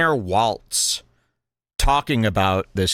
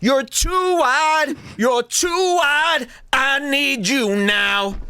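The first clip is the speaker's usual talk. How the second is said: very high, slow, sustained wail; mock pleading lover; 13 semitones higher, much slower than usual. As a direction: draw out each word in falsetto. Mocking a lover in bed.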